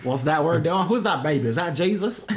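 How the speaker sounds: put-on Texan accent. Imagined Texan asking about the baby, mock Texan accent. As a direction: ask it as a puzzled Texan caricature. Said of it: "Texan just walks in with a shotgun".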